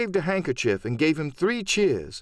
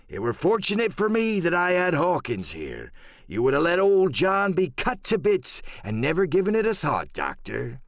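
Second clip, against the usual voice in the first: higher, wheedling pirate voice; old sea cook; 5 semitones higher than usual. Acted. Silver teasing the doctor about his fate, sly. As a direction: needle the doctor with sly gratitude.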